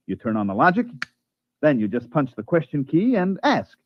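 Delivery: ordinary talk, nothing marked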